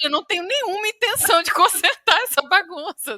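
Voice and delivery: high, loud, laughing through the words; laughing retold quote. Host quoting a baffled reaction to the mistake, laughing and high. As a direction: act out the reply, cracking up.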